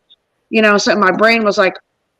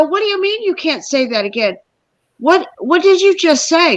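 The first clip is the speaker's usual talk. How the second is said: incredulous demand; 6 semitones higher than usual. Retold or imitated. Presses stranger to confirm the name.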